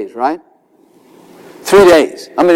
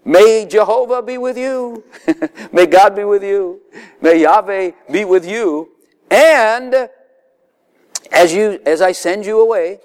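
voicing Pharaoh; 5 semitones higher than usual. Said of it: "this is the Pharaoh, here's his answer".

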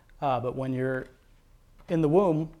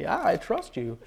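higher, insistent yes; mildly impatient assurance; 6 semitones higher, slower than usual. Retold or imitated. Believer answers God's repeated question, mildly impatient assurance. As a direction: reassure with a touch of impatience. Acted answer, asked a second time.

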